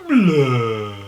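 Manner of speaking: bleu drawn out loudly. Shown as a demonstration. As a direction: belt out a long bleu. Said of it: "écoutez six fois".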